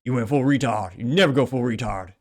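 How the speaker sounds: a hint of a movie actor's hammed-up voice, mostly his own; barely-there mock film-line delivery; terrible Robert Downey Junior impression. Vlogger redoing a Tropic Thunder meme line, badly imitated. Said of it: "a terrible impression of his impression".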